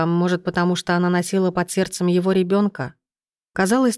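ordinary delivery, nothing marked

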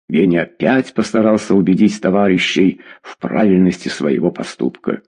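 ordinary delivery

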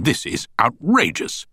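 understated indignant outburst; indignant objection. A narrator's reading. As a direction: object with mild outrage. Counsel leaps up to object.

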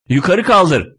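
yelling. Acted. Driver orders passenger to lift the handle, yelling. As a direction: call out a curt order. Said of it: "diye bağır bağır bağırıyor".

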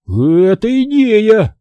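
high, very slow, delighted cry; light up at the idea; joyful exclamation. A narrator's reading. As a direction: Didi delighted by the plan to run off, joyful. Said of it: "радостно воскликнул Диди".

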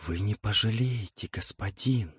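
soft seductive whisper. A narrator's reading. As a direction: hush it, promising pleasure. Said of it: "и прошептала".

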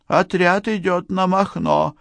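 high, reluctant character voice; high-pitched grudging admission; reluctant beekeeper. A narrator's reading. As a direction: give it up unwillingly, pitched high. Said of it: "нехотя сообщил пасечник".

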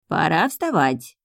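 slow, drawn-out owl wake-up call; owl's call. A narrator's reading. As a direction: stretch it out as a bird calling sleepers. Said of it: "услышали мы голос Аздали". (протяжный голос совы, будящей спящих)

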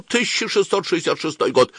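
high recited date, falling away; as if to oneself. A narrator's reading. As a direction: intone the year high, like a taunting refrain. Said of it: "начинал бормотать как бы про себя".

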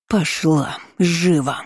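aunt's hissed order; hissing. A narrator's reading. Aunt ordering the girl indoors, hissing. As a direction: hiss the command, drawing out the words. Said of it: "зашипела тётка".

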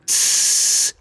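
soft, long "shh"; hushing hiss. A narrator's reading. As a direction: hush them with a sustained soft hiss. Creature hushes the questioner. (протяжное тихое шиканье)

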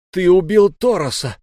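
high, loud anguished howl; howling accusation. A narrator's reading. Youth howling at the wolf, accusing him. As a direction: hurl the accusation with all your grief. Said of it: "взвыл я".

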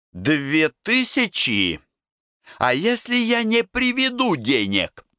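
slow, high "Две тысячи?", each word underlined; stressing each word. A narrator's reading. Semyon challenges the risky order, stressing every word. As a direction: pitch climbs sharply on "не приведу денег", every word leaned on. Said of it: "подчёркивая каждое слово".

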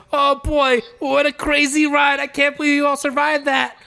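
high, hammed-up amazement in character; Turtle impression. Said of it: "And Turtle goes".

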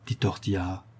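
usual speaking voice

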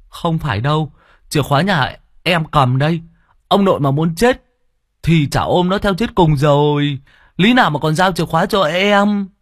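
put-on a plump kid cheerfully brushing off worry; chubby boy. Chubby Tâm answers reassuringly.

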